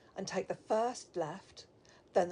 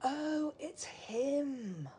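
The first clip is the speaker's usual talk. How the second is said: long, drawn-out, higher-pitched realisation; knowing recognition; 6 semitones higher, much slower than usual. Acted. Receptionist recognising the visitor's missing man, knowing and drawn out. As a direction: draw it out slowly as it dawns on you. Recognising the name Tom Smith.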